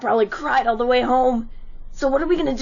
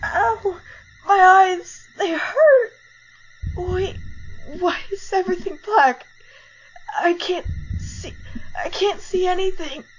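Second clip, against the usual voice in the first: higher, frightened cries; panicked; 4 semitones higher than usual. Acted. Blinded bully panicking, higher and scared.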